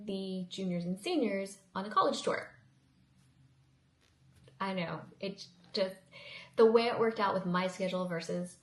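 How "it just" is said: ordinary talk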